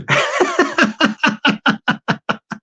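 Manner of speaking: long wheezing giggle fit; helpless laughter. Real, not acted. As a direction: burst out laughing at your own impression. Laughs at own impression.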